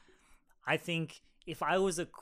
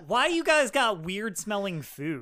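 mock blunt tourist; 7 semitones higher, 9 dB louder than usual. Mocking his friend's blunt question.

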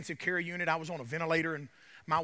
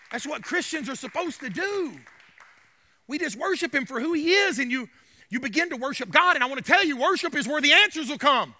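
urgent, rising sermon delivery; fervent preaching; 9 semitones higher, 11 dB louder than usual. Real, not acted. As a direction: press the congregation with conviction. Preaches on worship.

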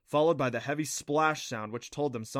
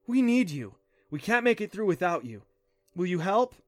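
higher, louder urgent appeal; earnest, raised-pitch plea; pleading; 6 semitones higher, 5 dB louder than usual. A narrator's reading. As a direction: plead for their help. Clancy pleads with the creatures.

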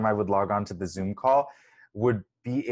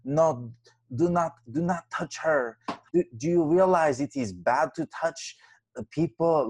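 Lindsay Lohan's put-on Eastern European accent, pitched higher; fake Eastern European accent; 6 semitones higher than usual. Retelling the viral clip, mocking Lindsay's fake Eastern European accent. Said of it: "speaking in a fake, like, weird Greek, Eastern European accent".

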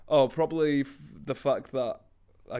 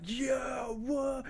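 high, hyped imitated yell; crowd going off; 13 semitones higher than usual. Retelling how the crowd went off, high mock yell. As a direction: whoop it like an excited crowd. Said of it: "the crowd went off".

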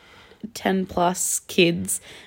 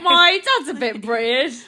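teasing put-on British accent, higher; mocking British imitation; 4 semitones higher than usual. Teasing co-host about the reply, mock British accent. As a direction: mimic a friend in hammed-up British. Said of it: "You say it like that?".

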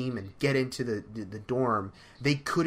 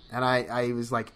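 ordinary talk, nothing marked

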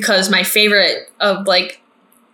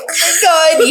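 excited gasp; 8 semitones higher, 3 dB louder than usual. Retold or imitated. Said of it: "I'm like".

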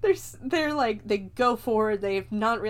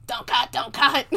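louder, put-on little creature voice; gremlin voice; 3 semitones higher, 6 dB louder than usual. Gremlin begging not to be cut down, creature voice. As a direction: plead like a cartoon gremlin. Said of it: "you can hear the gremlin go".

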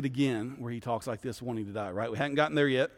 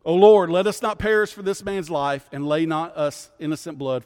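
loud, raised cry to the Lord, easing off; sailors' prayer, cried out then settling; calling out; 4 semitones higher, 8 dB louder than usual. Acted. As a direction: call out an urgent plea to God. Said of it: "they called out to the Lord".

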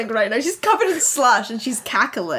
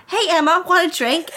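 put-on high-pitched mean-girl voice; Haley; 7 semitones higher than usual. School bully offering a drink, high girlish voice. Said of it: "This is, this is Haley".

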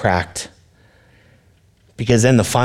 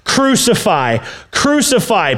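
rising mob chant; crowd shouting; 9 semitones higher, slower than usual. Acted. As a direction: build the chant higher each time. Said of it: "The crowds shout".

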